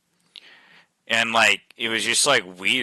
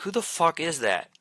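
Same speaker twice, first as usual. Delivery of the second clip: high-pitched puzzled reaction; mock bafflement, pitched up without volume; retold bewilderment; 7 semitones higher, 4 dB quieter than usual. Man reacting to a voice in the backyard, retold bewilderment.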